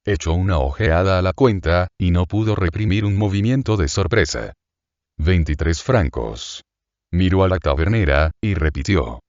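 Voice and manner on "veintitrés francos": plain reading voice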